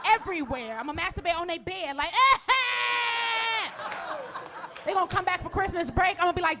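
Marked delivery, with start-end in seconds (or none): 2.2-4.8 long, high-pitched shriek; hammed-up wail of abandon; ecstatic howl. Mother alone celebrating on kids' bed, mock ecstatic shriek.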